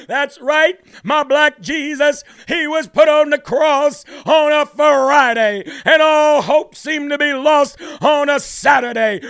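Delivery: loud, high hammed-up preacher shout; mocking the clip's preacher at full volume. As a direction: parody a fiery Sunday sermon. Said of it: "mock preacher imitation of clip".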